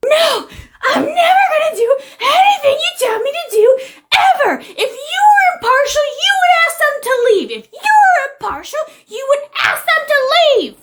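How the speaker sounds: very high, squealed refusal; shrieking tantrum. Acted. Woman refusing the officer, demanding others leave, shrieking. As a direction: screech it like a hammed-up tantrum.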